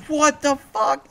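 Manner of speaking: high, stretched-out disbelief; stunned family outburst. Retold or imitated. Whole family reacting to his goal, high stunned outburst. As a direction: imitate a crowd's drawn-out astonishment. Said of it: "My whole family was like".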